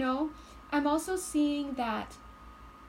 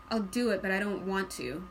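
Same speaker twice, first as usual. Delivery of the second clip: sighing, grudging agreement, sinking lower; reluctant; 4 semitones lower than usual. Acted. Reader voicing the crowned ant, reluctant agreement. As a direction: heave a sigh and give in. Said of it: "your energy is like".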